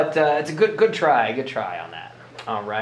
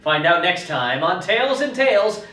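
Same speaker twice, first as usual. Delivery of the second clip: put-on booming kids show opener; 8 semitones higher than usual. Episode sign-off teaser.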